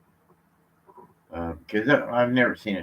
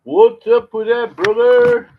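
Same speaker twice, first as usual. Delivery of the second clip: high, loud, drawn-out call; 13 semitones higher, 13 dB louder, slower than usual. Shown as a demonstration. Man calls the question again, high, loud and slow. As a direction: call it out much higher and louder, slowly. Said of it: "repeats it louder".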